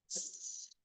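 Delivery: S said as a hissed sss sound; unvoiced S hissed as a sound, not the letter; sound not letter name. Shown as a demonstration. Teacher to trainees, S as a hissed sss sound. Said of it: "We're thinking about the sound here".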